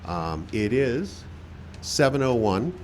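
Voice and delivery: ordinary talk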